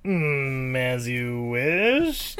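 very slow, creepy demon voice. Retold or imitated. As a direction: stretch it out like a sinister genie. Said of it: "the creepy-ass voice".

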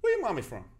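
high-pitched probing question; hammed-up nosy coach; quizzing coach. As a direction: interrogate a kid in comic puzzlement.

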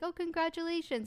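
gushing, high-pitched congratulations; mock friendliness. Stranger congratulating a newlywed, high and mock sweet. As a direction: imitate someone acting really nice. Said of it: "being really nice at first".

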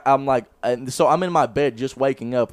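ordinary delivery

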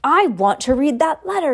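spoiled son loudly demanding the letter. Acted. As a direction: whine it loudly like a spoiled boy. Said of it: "he said loudly".

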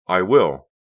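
plain reading voice

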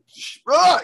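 high, loud comic sob burst; mock bursting into sobs. Brother told not to cry, bursts out in mock sobs. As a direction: try to hold it in, then burst. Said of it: "Don't cry".

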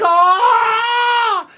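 very high crying shout; scream of pain. Retold or imitated. Patient as catheter hits, howling. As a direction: howl in pain.

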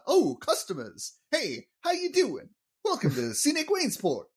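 bad comic impression, high-pitched and swooping; hammed-up high-pitched salesman impression; Rodney Dangerfield. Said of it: "that is my horrible impression".

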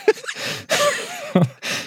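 squealing high laugh. Real, not acted. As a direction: burst into laughter.

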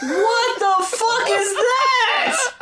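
high squealing laugh through the words, dragged out; laughing disbelief. Real, not acted. Co-host reacting to a ridiculous lyric, laughing high and slow.

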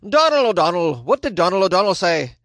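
attempted Irish accent, not quite landing; bad Irish accent. Vlogger mimics the Irish justice, bad Irish accent. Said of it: "I can't do an Irish accent".

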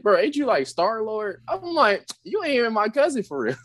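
high, teasing voice; high mock retort. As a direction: tease him in a high voice.

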